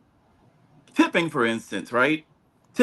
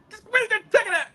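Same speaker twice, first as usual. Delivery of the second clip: high, laughing mock of an impatient conductor; rude Amtrak conductor; 14 semitones higher, faster than usual. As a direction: squeak out a hammed-up rude clerk through laughter. Rude Amtrak staff mocked, laughing.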